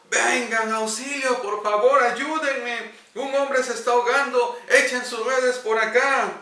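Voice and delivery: high, loud cries for help from the water; desperate shout. Acted. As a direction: yell to the fishermen, begging. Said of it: "les comenzó a gritar". (gritos desesperados de auxilio)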